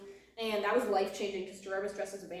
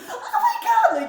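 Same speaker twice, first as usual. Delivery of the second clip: very high, loud fangirl squeal; imitating mom's squeal; 10 dB louder, much slower than usual. Imitating mom squealing at the band, very high and loud. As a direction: squeal it like a thrilled fan. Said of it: "She's like".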